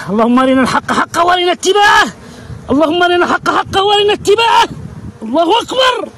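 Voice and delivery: prayer climbing into a high cry; fervent exclamation. Real, not acted. Beekeeper praying aloud over his hive, fervent and rising.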